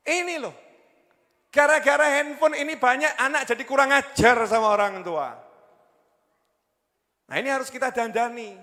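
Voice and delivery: high, loud preacher's complaint; loud scolding sermon; real shouting. Real, not acted. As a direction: raise your voice and drive it home to the crowd. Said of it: "Saya akan teriak-teriak".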